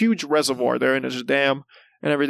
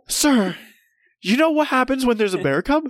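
frantic warning; 7 semitones higher than usual. Retold or imitated. Son warning his dad about the mama bear, high frantic plea. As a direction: plead with mounting mock panic, laughing.

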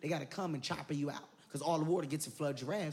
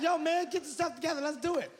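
higher hyped-up buddy voice; imitating friend; 9 semitones higher than usual. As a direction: pump the crew up in a high voice. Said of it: "now you like".

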